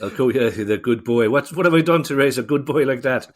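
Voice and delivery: hammed-up Irish accent of a proud mother. Host playing Kieran's proud Irish mother, hammed-up Irish accent. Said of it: "sorry about it for the Irish accent".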